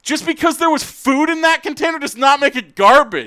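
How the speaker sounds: high, loud indignant rant; worked-up, emphatic yelling; exasperated shouting. Retold or imitated. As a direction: yell it like scolding a roommate who never listens. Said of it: "you shouted at him".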